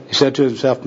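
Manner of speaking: normal talking voice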